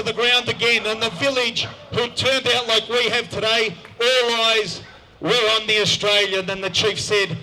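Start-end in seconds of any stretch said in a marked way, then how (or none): none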